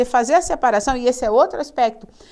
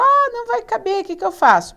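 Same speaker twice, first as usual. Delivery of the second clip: higher, louder fretting over no room; imitated worried complaint, pitched up; mock student panic; 10 semitones higher, 4 dB louder than usual. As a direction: act out a student in a fix. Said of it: "Aí você fala".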